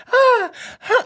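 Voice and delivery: very high, loud mock moan; imitated moan. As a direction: imitate high, short cries overheard. Retold moans overheard from bedroom.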